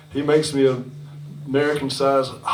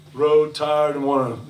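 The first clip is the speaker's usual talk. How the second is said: ordinary delivery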